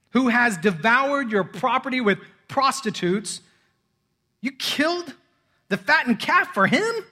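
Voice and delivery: high, loud, indignant complaint; angry older son. A narrator's reading. Older brother complaining to his father, indignant and angry. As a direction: protest like a wronged son. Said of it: "he was angry and refused to go in".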